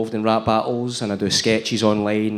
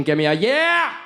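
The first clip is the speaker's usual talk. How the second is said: hype man call; 10 semitones higher, much slower than usual. Acted. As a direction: call out to the crowd. Warming up the crowd.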